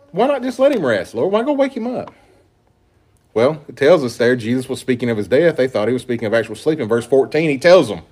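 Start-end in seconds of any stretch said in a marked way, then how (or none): 0.1-2.1 higher-pitched, mock-practical questioning; puzzled objection. Preacher voicing the disciples' doubts, puzzled. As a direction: voice a baffled, reasonable objection.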